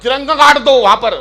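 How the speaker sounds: loud, high patriotic poet cry; hammed-up veer ras declamation; mock heroic poet. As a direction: parody a war poet shouting orders. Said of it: "ये सब लोग मतलब ये बोलते हैं".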